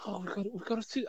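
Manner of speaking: dour Scouse. Retold or imitated. Host mimics Duffy commentating on the match, mock dour Scouse. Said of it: "really sort of dour Scouse".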